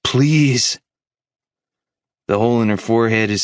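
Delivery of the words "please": high, drawn-out plea; begging. A narrator's reading. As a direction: beg with a raised, grieving voice.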